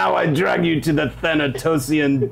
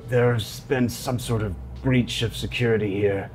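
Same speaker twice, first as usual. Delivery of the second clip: ordinary talk, nothing marked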